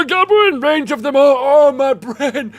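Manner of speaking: high, loud overwhelmed gasping; a hammed-up sensory overload; overstimulated Dante. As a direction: reel as the senses flood in. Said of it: "I imagine Dante would just get heavy overstim".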